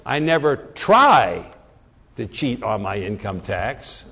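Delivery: slow build to an emphatic "try"; stress on TRY. Shown as a demonstration. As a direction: punch "try", then drop "to cheat on my income tax" low. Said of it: "Now, if I say".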